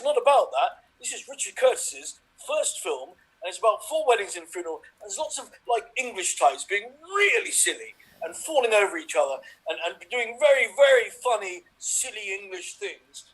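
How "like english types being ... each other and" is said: ordinary talk, nothing marked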